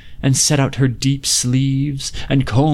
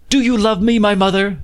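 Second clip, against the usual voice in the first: put-on much higher, boyish plea to his mother; pleading son; 8 semitones higher than usual. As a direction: coax her like a young boy, high.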